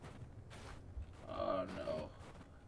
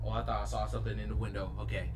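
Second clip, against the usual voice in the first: ordinary talk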